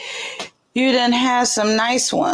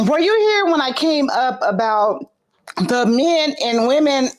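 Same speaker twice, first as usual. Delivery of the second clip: normal talking voice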